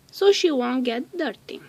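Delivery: plain reading voice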